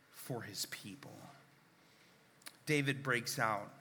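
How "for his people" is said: whispered. Real, not acted. Whispered close.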